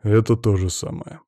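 ordinary delivery

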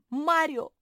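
high, loud summons; shouting. A narrator's reading. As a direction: call out boldly to someone who must hear. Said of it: "закричала Мафи".

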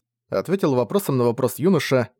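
usual speaking voice

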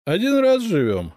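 put-on high, loud drunkard's boast; proud tippler showing off; puffed-up swagger. A narrator's reading. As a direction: puff yourself up as you say it. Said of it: "приосанился пьянчуга".

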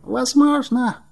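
high voice of an unsure dragon; doubtful water dragon. A narrator's reading. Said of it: "с сомнением в голосе". (высокий голос сомневающегося дракона)

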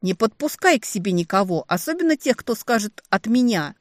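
high, loud, indignant telling-off; indignant scolding. A narrator's reading. Older sister reminding the younger of her warning, indignant scolding. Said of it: "взорвалась негодованием я".

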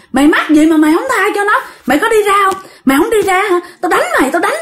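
high, quick, threatening rebuke; scolding old man. Acted. Old man threatens the ghost to leave her alone, high and scolding. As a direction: demand that he get out, fast and high. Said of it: "Thì ông già đó ông mới nói".

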